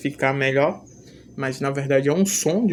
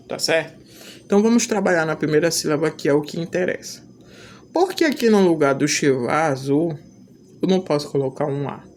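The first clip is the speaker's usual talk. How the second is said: usual speaking voice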